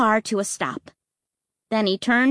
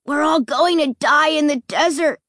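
high, louder mock doom; gruesome glee; 7 semitones higher, 4 dB louder than usual. Luke teasing his scared brother, gleeful mock doom. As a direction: relish the horror. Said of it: "gruesome grin".

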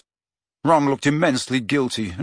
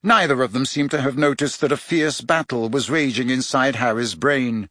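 usual speaking voice

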